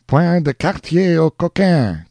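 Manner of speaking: high eager cry in French; a French soldier crying out eagerly. Acted. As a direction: call out the order in French. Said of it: "cried an eager pursuer".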